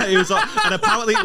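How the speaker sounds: high, laughing story. Real, not acted. To his co-host about the DJ, high and laughing. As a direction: tell it through laughter.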